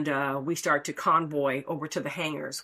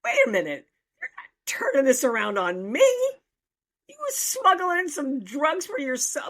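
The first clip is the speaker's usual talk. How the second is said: indignant retort; 8 semitones higher than usual. Retold or imitated. Calling out a friend for smuggling, rising high indignation. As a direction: turn it back on him, outraged. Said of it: "I'm like".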